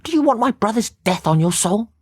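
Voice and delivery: high, pleading sister voice; desperate to save her brother; distressed pleading. A narrator's reading. Sister begs detective not to stop them, high and pleading. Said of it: "In her distress".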